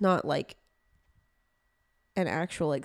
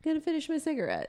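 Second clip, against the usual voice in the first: mock model voice; 7 semitones higher, 4 dB quieter, faster than usual. Voicing the model, dismissive.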